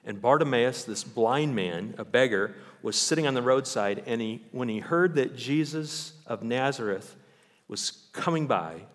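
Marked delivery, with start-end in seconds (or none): none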